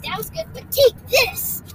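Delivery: high, punchy one-upping boast; mock challenge. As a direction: throw it down like a playful challenge. Voicing the firework rival's boast.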